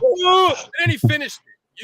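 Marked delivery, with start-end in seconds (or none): 0.0-0.5 imitated yawn. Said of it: "he's like".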